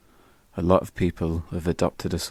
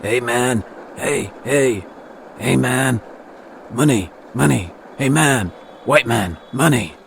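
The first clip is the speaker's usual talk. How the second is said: higher and louder, pleading calls; begging man; 6 semitones higher, 4 dB louder than usual. Retold or imitated. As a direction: call out insistently like a street beggar. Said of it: "all he says is".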